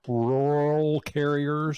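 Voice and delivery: rural carriers, slowly sounding out rural; working carefully through the word rural; slow, effortful rural carriers; careful pronunciation. Real, not acted. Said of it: "I'm not gonna be able to say it".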